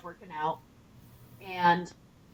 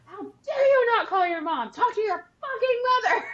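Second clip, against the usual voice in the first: high, loud scolding shout; furious shout; 9 semitones higher, 4 dB louder than usual. Retold or imitated. Wife scolding the soldier for ignoring his mom, shouting. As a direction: let him have it, outraged mom. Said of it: "me being ten times louder than Joey".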